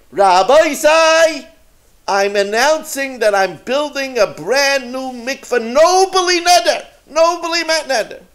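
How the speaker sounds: high, loud proclamation to the whole shul; loud public announcement. Retold or imitated. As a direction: announce it grandly to the congregation.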